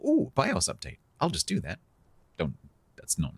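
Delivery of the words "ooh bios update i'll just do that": high, bright mock enthusiasm for a bios update; mock eager. Streamer mocking viewers who rush a bios update, much higher mock-eager voice. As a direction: play the overeager fool who updates for fun. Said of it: "things we go".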